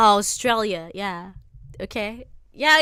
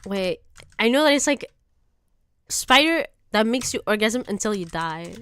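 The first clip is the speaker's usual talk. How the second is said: ordinary delivery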